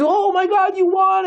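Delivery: high, loud joyful shout; father overjoyed at the news, shouting; excited shout. Retold or imitated. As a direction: cry it out, thrilled for your daughter. Father hears daughter won.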